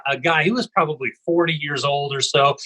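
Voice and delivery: normal talking voice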